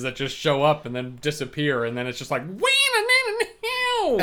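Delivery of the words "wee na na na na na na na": high squealing riff imitation; imitated guitar riff. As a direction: sing the riff high and fast.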